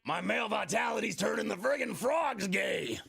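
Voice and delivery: high, hammed-up conspiracy-host shout; mock an outraged ranter at full voice; Alex Jones rant. Mocking Alex Jones, shouting about frogs.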